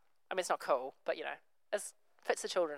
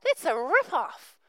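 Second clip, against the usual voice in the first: slow, higher indignant whine; complaining; 9 semitones higher, 7 dB louder, slower than usual. Retold or imitated. Early workers complaining to the master, slow indignant whine. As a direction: drag out the protest. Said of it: "these first guys are like".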